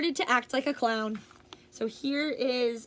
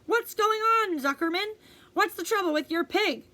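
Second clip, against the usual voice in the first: loudspeaker voice, higher and alarmed; worried announcer; 7 semitones higher, 4 dB louder than usual. Acted. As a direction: call down to the farmer in concern. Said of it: "asked the loudspeaker".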